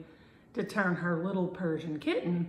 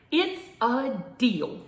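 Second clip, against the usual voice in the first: very happy; 6 semitones higher, 8 dB louder, slower than usual. A narrator's reading. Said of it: "sounding very happy with his new friend".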